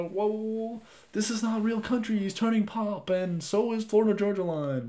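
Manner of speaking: high, outraged purist voice scoffing at pop; mock country purist. Podcaster mocking country purists, high scoffing outrage. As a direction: whine it like a fan betrayed by pop. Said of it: "Some people are".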